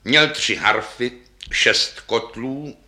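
ordinary delivery, nothing marked

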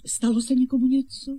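usual speaking voice

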